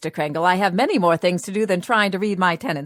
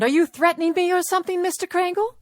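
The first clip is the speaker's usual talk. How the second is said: indignant alarm; 9 semitones higher than usual. Acted. Tenant accuses landlord of threatening.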